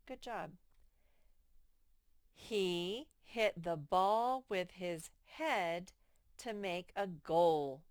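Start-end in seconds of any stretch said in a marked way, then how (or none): 2.3-7.8 slow, careful reading of a sentence; reading aloud slowly to children. Shown as a demonstration. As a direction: read it slowly for the kids. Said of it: "reading sentence slowly aloud".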